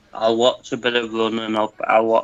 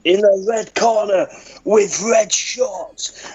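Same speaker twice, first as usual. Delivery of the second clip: high, slow ring announcer voice, drawn out; boxing ring announcer; 7 semitones higher, slower than usual. Shown as a demonstration. Mock ring announcer introducing a toothless fighter to friends, high and slow. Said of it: "Do a boxing call?".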